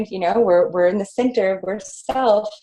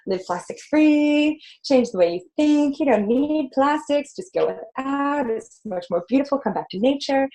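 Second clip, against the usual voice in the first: upbeat coaxing; 7 semitones higher than usual. Acted. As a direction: coax them along in a lilting voice. Voices an upbeat plastic-free message.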